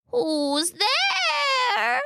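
high, quavering fake old lady; the wolf putting on his best granny; wolf's grandmother voice. Wolf in bed answering the knock, faking grandmother. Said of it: "using his best grandmother voice".

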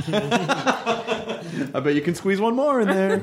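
high, slow, giggling; laughing. Pretend. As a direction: laugh through the line. Joking clown bit.

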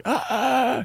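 put-on older Italian guy. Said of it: "fat, older Italian or Latin guy".

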